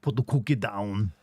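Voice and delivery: lightly voiced Austrian Arnold; low, deliberate Austrian accent. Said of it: "my trigger for doing an Arnold voice".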